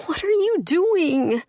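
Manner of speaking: high, loud shocked cry; alarmed cry. A narrator's reading. Horrified woman seeing him bleed, loud alarmed cry. Shocked at his self-wounding.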